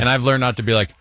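normal talking voice

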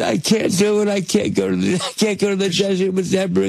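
high-pitched, half-crying plea; mock tearful protest. Retelling his plea to the studio about the actress, mock tearful. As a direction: plead as if close to tears, played for laughs. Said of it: "I think I started crying".

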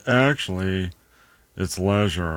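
drawn-out snooty correction, "leisure" as LEH-zher; snooty person. Acted. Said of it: "some snooty person's gonna be like".

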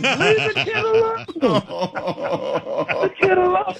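very high, laughing mock yell; retold scream. Reggie yelling at the manager to leave the rookie, mock yell. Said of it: "he starts screaming at Dick Williams".

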